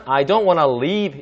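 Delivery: "leave" with a long, tense "ee"; long ee sentence. Shown as a demonstration. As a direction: say the sentence stretching the vowel of "leave". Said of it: "이렇게 말하면은".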